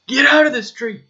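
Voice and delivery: put-on shouting at a kid standing in the road; urgent yell. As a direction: yell to save someone from a car. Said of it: "you have to yell".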